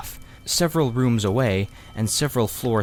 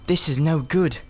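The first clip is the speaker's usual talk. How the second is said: lightly voiced young boy; a boy a little higher, uneasy; Diggory; 6 semitones higher than usual. A narrator's reading. Diggory wanting to leave the house, lightly voiced boy.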